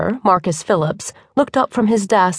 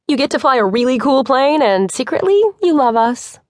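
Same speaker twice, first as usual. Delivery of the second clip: teasing; 5 semitones higher than usual. A narrator's reading. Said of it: "Bree teased".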